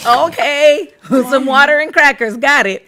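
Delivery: mock playing along. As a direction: go along with the tea party, amused. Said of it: "And I'm like".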